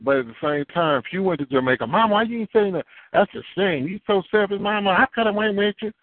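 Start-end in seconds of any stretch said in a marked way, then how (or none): 1.9-5.9 much higher, complaining voice; whiny grown child. Retold or imitated. Grown child complaining to mama, high and whiny. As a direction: pout it at mama. Child scolding mother for not saying.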